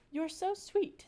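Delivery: high, light and sweet; sweet princess voice. Acted. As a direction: coo it like a gentle princess. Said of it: "said the princess".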